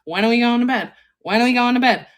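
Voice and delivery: high, loud whiny cat demanding bed. Retold or imitated. Cat nagging owner at night, high and loud. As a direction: pester like a needy cat.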